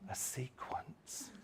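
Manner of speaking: slow, whispered a sequence; whispered wonder. Shown as a demonstration. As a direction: let a sequence hang in a whisper. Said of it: "say words with passion".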